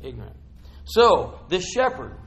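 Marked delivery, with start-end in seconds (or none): 0.0-0.4 "Ignorant" said softly and low, falling, rueful; quiet. Real, not acted. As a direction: murmur "Ignorant" as a quiet admission. Repeats word quietly about himself.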